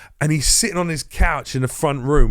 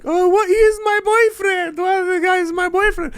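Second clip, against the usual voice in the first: mock crying; 4 dB louder than usual. Man imitating a girl crying over her boyfriend, mock wailing. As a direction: wail it like a crying girl, exaggerated. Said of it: "some girl starts crying her eyes out".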